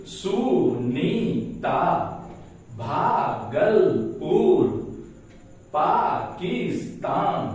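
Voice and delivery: slow names, each broken into swinging beats; syllable by syllable. Shown as a demonstration. Teacher listing examples of syllable-based reading, slow separate beats. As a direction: chop "Sunita" through "Tamil Nadu" into drawn-out syllables. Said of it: "syllable के आधार पर".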